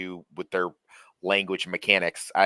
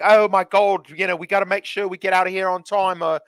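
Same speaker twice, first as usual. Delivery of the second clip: mock British panic; 9 semitones higher, 7 dB louder than usual. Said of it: "they'd be like".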